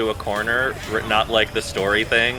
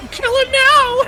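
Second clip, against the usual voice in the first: shrill villager; 6 dB louder, slower than usual. Retold or imitated. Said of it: "She was like".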